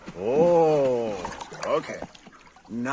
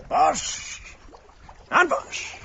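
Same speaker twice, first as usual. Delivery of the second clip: much higher sing-song cue word; 12 semitones higher, slower than usual. Real, not acted. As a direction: call the cue up high and bright. Bright cue to trick bird.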